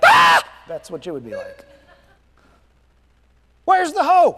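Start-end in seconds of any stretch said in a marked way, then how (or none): 0.0-0.4 short, loud acted scream of alarm; startled scream. Preacher acting out a gardener startled by a talking snake, loud scream. 3.7-4.4 high, loud panicked cry; mock panic. Preacher playing a gardener after a snake speaks, loud mock panic.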